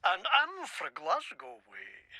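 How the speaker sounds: slow, soft try at a Glasgow accent; quietly testing a Scottish accent line; practised Scottish accent. Shown as a demonstration. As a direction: rehearse a Scottish intro, slowly. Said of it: "Shit, that's not it".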